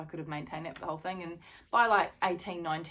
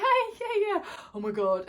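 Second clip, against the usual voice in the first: high, loud mock cheer; excited calling out; 7 dB louder than usual. Runner waving to Pete and the girls, excited high call. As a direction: call out high to family in a crowd.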